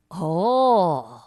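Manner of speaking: guard voice. Acted. Guard responds.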